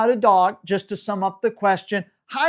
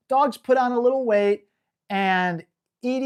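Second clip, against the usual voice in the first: usual speaking voice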